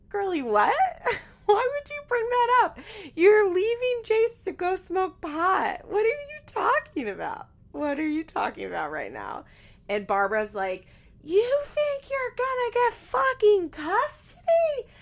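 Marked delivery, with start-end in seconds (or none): 0.1-7.5 very high, loud mock-outraged squeal; incredulous squeal. Host reacting to Janelle's custody remark, incredulous squeal. 11.2-14.9 very high, loud, drawn-out mock scream; imitated screaming. Imitating Barbara screaming at Janelle about custody, mock scream. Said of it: "Like, screaming".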